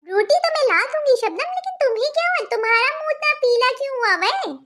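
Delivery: put-on a worried older aunty checking on Shabnam; concerned aunty.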